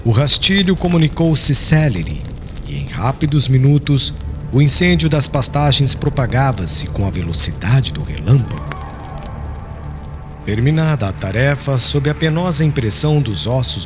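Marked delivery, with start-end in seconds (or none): none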